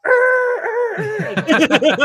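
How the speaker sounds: high, loud revving engine roar; car engine noise. Retold or imitated. Car roaring off after the angry guest, loud revving. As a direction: rev it like a car peeling away. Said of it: "his car going".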